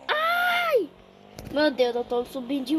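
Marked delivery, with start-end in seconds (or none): 0.1-0.9 a sudden high startled cry; startled yelp. Real, not acted. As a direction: jump at the shock and yelp. Startled by the game.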